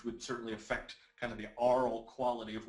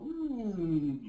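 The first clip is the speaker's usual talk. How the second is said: descending hum; 7 semitones higher, 4 dB louder than usual. Shown as a demonstration. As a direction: hum from high sliding downward. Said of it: "So we're gonna go".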